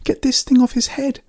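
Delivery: very high, urgent cry; high-pitched alarm; panicked cry. A narrator's reading. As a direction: cry it out as a frantic order. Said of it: "Amanda cried".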